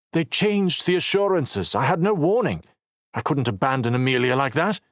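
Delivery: hot, rising indignation; heated. A narrator's reading. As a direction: let the heat into your voice. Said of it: "Heat filled Knox's voice".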